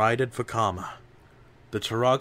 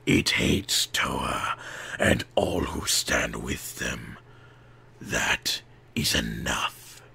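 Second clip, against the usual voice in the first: low, slow, whispered villain; a menacing shadow whispering; Makuta's voice; 10 semitones lower, slower than usual. A narrator's reading. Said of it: "said the voice of Makuta".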